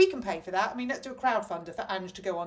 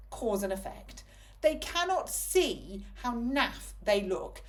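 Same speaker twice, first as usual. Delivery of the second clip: slow exasperated; 3 semitones higher, much slower than usual. Real, not acted.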